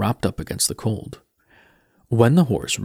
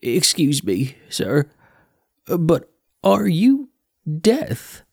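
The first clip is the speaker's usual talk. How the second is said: rising higher, unsure question; timid boy voice, higher; nervous young Mort; 6 semitones higher, slower than usual. Acted.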